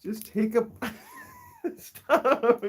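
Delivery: high-pitched plea through real giggles; squealing with laughter; laughing protest. Real, not acted. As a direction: beg the pet to stop while cracking up. Laughing as pet tugs food.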